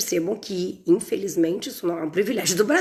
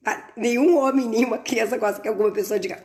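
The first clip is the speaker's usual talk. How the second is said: ordinary talk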